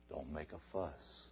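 a low, soft, sly devil's voice; very soft devil whisper; hushed devil. Acted. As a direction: murmur it like a tempter. The devil's voice speaking.

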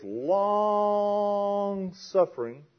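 LONG held high for a long moment before "suffering"; drawn-out LONG. Real, not acted. Preacher illustrates God's patience, "long" hugely drawn out. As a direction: stretch "long" way out on a sustained high note.

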